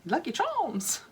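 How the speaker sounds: mock Irish accent. Vlogger jokes about the cereal in a weak Irish accent. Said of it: "better accent than that".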